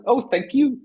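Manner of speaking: very high, chirpy robot thanks; high squeaky thank you. Retold or imitated. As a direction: squeak it up like a grateful little robot. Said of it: "they said".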